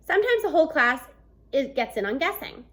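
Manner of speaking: usual speaking voice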